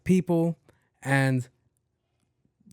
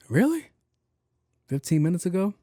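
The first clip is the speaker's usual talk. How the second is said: mock surprise; 6 semitones higher than usual. Mock disbelief at cop.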